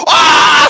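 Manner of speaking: sudden high, very loud scream; startled scream. Real, not acted. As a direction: scream out mid-sentence. Interrupted by a shock picture.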